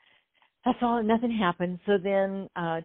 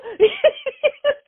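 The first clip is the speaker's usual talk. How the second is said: high laugh; 9 dB louder than usual. Real, not acted. Laughs at own joke.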